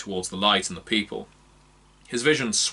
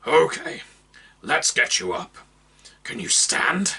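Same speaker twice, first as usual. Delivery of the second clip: gruff concern; 6 semitones higher than usual. Acted. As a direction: ask gently but gruffly. Orc helps injured man.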